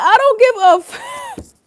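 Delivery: very high, loud, drawn-out mocking voice; mock Queen of Swords. Streamer voices the card's face, exaggerated not caring. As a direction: squeal it slowly as the brazen queen. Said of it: "She's like".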